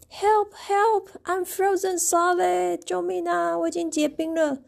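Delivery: high, playful pretend distress call; mock cry for help. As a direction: call for help like a hammed-up victim. Elmer fakes being frozen.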